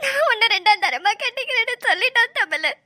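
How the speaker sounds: crying through a painful retelling; upset, weepy, gulping sobs between phrases; sobbing. Acted. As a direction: repeat his words in tears. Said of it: "தேம்பி தேம்பி அழுதுனா".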